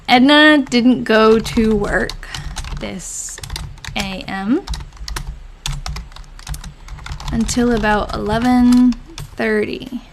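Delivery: slow, halting read-aloud while typing; slow dictation. Real, not acted. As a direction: read it out softly in small chunks, with long pauses. Reads diary aloud while typing.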